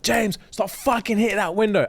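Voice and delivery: imitating furious mum. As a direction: play an exasperated mum telling off her kid. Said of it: "my mum would go fucking insane".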